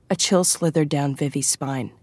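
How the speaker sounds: ordinary delivery, nothing marked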